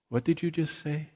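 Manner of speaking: softly acted, hushed double take; mock puzzled. Distracted Bible reader suddenly stopping, mock puzzled. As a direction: ask it quietly, as if just tuning back in.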